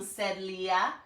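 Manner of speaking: ordinary delivery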